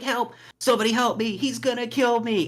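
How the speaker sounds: high-pitched cry for help; mock screaming. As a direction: imitate a panicked horror-film victim. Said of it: "he's screaming".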